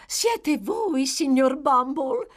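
high, sweetly surprised greeting; coy matron. A narrator's reading. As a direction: flutter at the visitor. Matron greets visitor coyly.

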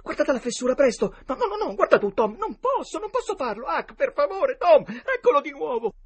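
very high, loud, frantic voices; panicked pleading. A narrator's reading. Boys too scared to look through the crack, panicked pleading. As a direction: refuse and beg in rising terror. Terrified boys hear the dog.

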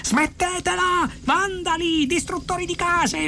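very high, strained outcry; furious yelling. A narrator's reading. Arthur runs at the demolition crew, furious yelling. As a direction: scream abuse at them, stretching the words. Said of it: "urlò".

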